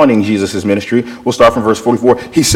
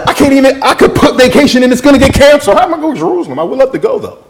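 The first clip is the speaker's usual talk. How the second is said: high-pitched incredulous protest; exasperated protest; 12 semitones higher, faster than usual. Retold or imitated. Milkman tells friends why Jerusalem is impossible, high exasperated protest. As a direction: plead helplessly, voice pitched way up.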